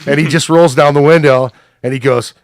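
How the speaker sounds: ordinary talk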